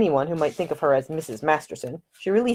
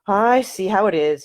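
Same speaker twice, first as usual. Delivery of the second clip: mock sigh; 4 semitones higher, 4 dB louder than usual. A narrator's reading. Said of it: "he sighed".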